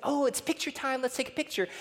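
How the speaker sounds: high, bright coaxing call; sing-song parent. Retold or imitated. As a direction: coax a child for a photo. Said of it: "the moment everyone said".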